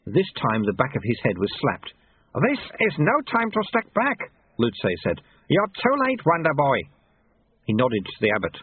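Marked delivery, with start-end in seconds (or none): 2.5-4.3 high-pitched rebuke; urgent old master; scolding sweeper. A narrator's reading. Sweeper rebukes his apprentice. 5.5-6.8 high, taunting master; old sweeper's raised-pitch jibe; scolding sweeper. A narrator's reading. Sweeper rebukes his apprentice.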